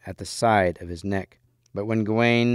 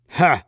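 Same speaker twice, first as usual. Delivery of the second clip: short, loud, higher "Ha!"; scornful grunt; 6 semitones higher, 7 dB louder than usual. A narrator's reading. Green Knight lowers his axe, grunting. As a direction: grunt it out sharply. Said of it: "grunted the Green Knight".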